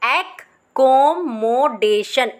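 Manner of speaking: कोमोडेशन slowly joined. Shown as a demonstration. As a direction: blend it together slowly. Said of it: "ऐसे आप इस spelling को learn करोगे". (धीरे जोड़कर)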